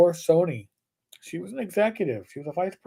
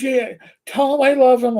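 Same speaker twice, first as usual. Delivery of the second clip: put-on gushing girlfriend; 8 semitones higher, 6 dB louder than usual. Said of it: "She goes".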